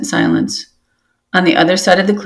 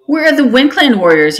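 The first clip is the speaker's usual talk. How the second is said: yelled; 7 semitones higher than usual. A narrator's reading. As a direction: demand it angrily from below. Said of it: "yelled another".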